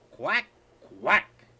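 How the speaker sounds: loud, high quacks; duck captain crying. Acted. Grandpa voicing the duck captain calling out, loud quacks. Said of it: "the captain cried".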